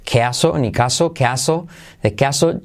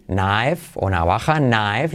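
normal talking voice

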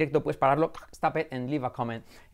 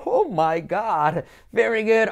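mock amazed praise; 6 semitones higher, 6 dB louder, slower than usual. Mock praise after drill.

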